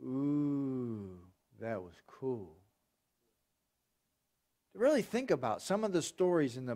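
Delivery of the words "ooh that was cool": mock awe. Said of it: "We can almost say".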